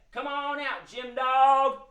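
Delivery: sing-song call, drawn out. Pretend. Host leading children to summon the dog, calling out.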